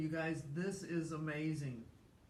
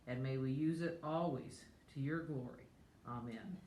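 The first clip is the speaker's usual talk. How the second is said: soft, steady prayer voice; 3 dB quieter than usual. Real, not acted. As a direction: say grace gently. Leads grace before meal.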